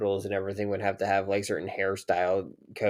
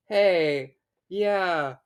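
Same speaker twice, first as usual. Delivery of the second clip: incredulous; 10 semitones higher than usual. Retold or imitated. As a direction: say it like it's ridiculous. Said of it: "I'm like".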